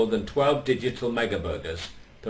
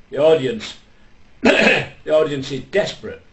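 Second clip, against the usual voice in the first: projecting louder to the room; loud announcement; 4 semitones higher, 7 dB louder than usual. Acted. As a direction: call it out like a compere. Announcing loudly to Adolf.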